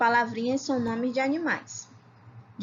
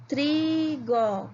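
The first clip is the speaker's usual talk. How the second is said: "Trigo" split into tri, go, on a higher pitch; slow syllable by syllable; 4 semitones higher than usual. Shown as a demonstration. Teacher checking syllable counts with pupils, "Trigo" slowly split. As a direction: say "Trigo" as two long syllables. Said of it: "Vamos falar todas devagarinho".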